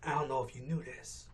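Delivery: low, hushed fake secret; soft, low, tongue-in-cheek aside; mock confidential aside. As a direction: let the viewer in on a pretend secret, very softly. Mock confidential advice to viewers.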